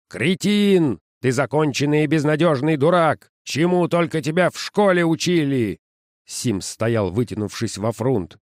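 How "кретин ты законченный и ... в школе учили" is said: angry boss. Acted. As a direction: rage at the fool. Boss berating Sims.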